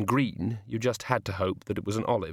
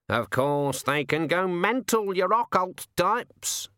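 much higher, slow teasing raven; 8 semitones higher, slower than usual. Acted. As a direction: needle him like a cheeky bird. The raven chats in flight.

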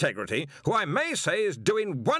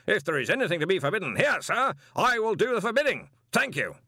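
louder, commanding indignant rant; sudden fury; 7 semitones higher, 4 dB louder than usual. A narrator's reading. Arch-Chancellor rebukes the intruder in his own college, furious. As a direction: put him firmly in his place. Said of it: "in sudden fury".

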